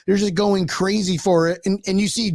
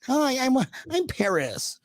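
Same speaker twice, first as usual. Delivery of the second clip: put-on high breathy-free girly intro, slowing at the end; mock Paris Hilton; 8 semitones higher, 4 dB quieter, much slower than usual. Host mocking Paris Hilton to cohost, drawn out. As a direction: play the starlet seeking attention. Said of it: "she's trying to be relevant again".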